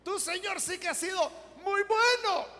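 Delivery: loud, high thanks to God; grateful prayer. Retold or imitated. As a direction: cry out thanks like the grateful old woman.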